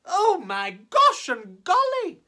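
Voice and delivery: startled elephants cry out. Acted. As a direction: gasp it out in shock. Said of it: "jumped and fell all ways in surprise".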